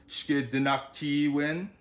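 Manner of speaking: SHKIDINAKIWIN slowly, holding the KI; slow Shkidinakiwin, syllables laid out; long double I. Shown as a demonstration. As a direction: draw out the ee of ki in Shkidinakiwin. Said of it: "there's a double I there".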